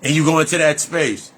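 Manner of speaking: ordinary talk, nothing marked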